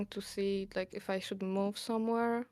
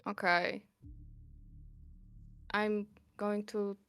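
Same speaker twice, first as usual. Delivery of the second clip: ordinary delivery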